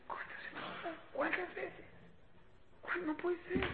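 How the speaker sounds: soft, tight telling-off. Shown as a demonstration. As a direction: scold under your breath, exasperated. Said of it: "si subiste o bajaste".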